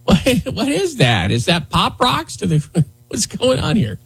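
put-on high laughing valley girl shriek; mock clueless reporter panic. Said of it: "Somebody starts shouting".

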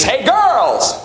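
high, drawn-out comic call to women; mock come-on. Man with odd posture advertises himself to girls, mock come-on. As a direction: call it out high, stretching the words. Voices what a body stance says.

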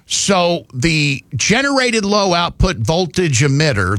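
ordinary delivery

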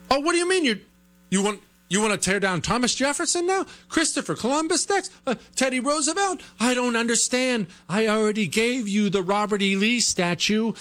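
high, whiny confused politician; mock bewildered pushover. Host mocking a Republican who gave up the Lee statue, whiny and high. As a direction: play a spineless politician caught off guard.

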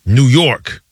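loud, proud "New York" with weight on York. Retold or imitated. Friend answering the office pool, loud proud "New York". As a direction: declare "New York" like a hometown chant. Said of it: "he was like".

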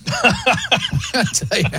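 slow words through laughter; laughing. Real, not acted. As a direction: chuckle out the words slowly. Laughing at own joke.

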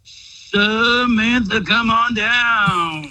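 slow, booming game show call; game show host. Acted. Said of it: "talking to me like a weird game show host".

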